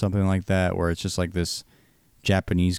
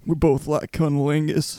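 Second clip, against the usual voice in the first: slow, stretched-out Clinton voice; drawn-out folksy Southern president; imitating Bill Clinton; Bill Clinton impression; 8 semitones higher than usual. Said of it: "Owen Wilson and Bill Clinton".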